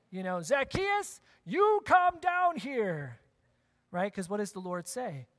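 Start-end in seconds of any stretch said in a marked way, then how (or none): 0.4-3.2 shouted call. Shown as a demonstration. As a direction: shout it up the tree, higher and slow. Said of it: "usually you shout that".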